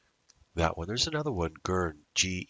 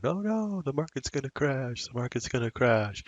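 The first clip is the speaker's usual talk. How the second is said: hammed-up high panicked wail, much higher; mock panic; 9 semitones higher than usual. Trader mocking panicking investors, hammed-up high wail. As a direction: mimic a frightened investor crying doom. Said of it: "they're all freaking out going".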